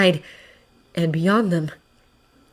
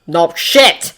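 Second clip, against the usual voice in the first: ordinary delivery, nothing marked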